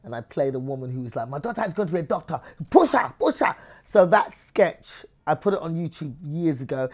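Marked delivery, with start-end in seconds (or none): 1.3-3.5 comic pushy-mum character voice; pushy mother. Acted. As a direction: play an overbearing mother driving her daughter on. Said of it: "she was a pushy mother".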